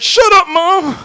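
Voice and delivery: put-on high, loud kid snapping at mom; a sulky kid talking back; bratty snap. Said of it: "I was like".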